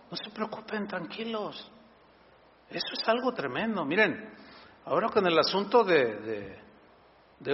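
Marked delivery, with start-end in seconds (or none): 0.1-1.7 reassuring. Retold or imitated. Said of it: "Jesús dijo".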